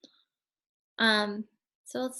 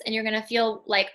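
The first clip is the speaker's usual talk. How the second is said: normal talking voice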